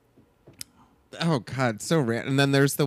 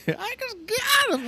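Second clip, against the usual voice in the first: high wailing sobbing scream; screaming crying girl. Retold or imitated. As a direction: wail it out like a hysterical teen. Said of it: "She's screaming".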